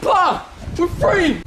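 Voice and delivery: excited shouting. Acted. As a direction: shout the news to the family. Peasant celebrates freedom, calls parents.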